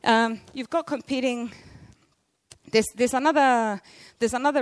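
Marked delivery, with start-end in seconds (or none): none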